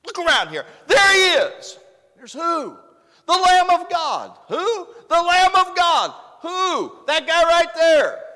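high, very loud cries; excited shouted announcement. Acted. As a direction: shout it out to a crowd of onlookers. Acts John announcing Jesus to onlookers.

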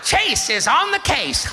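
high, loud, drawn-out cartoon hero cry; cartoon catchphrase. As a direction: proclaim it like a kids' show hero. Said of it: "Paw Patrol, my da-, my granddaughter".

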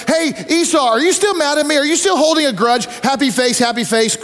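high, light mock-casual message to a brother; lightly voiced sheepish little brother; playful texting voice. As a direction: chirp it like a breezy text. Imagined text to his brother.

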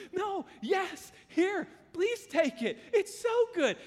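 put-on high, loud, excited child's plea; eager kid. Pastor voicing the poor kid offering his tortilla, high and excited. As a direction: push the food on him like a thrilled boy.

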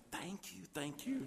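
hushed, very soft aside; whispering. Retold or imitated. Husband aside while wife protests to florist, whispered thanks. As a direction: whisper a grateful thanks under the breath. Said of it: "And I'm whispering".

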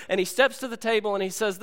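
ordinary talk

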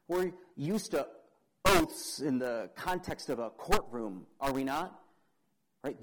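normal talking voice

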